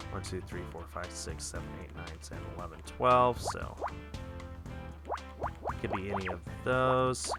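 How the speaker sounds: usual speaking voice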